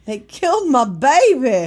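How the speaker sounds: loud high hammed-up lament; mock wailing mother. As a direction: wail over the lost baby.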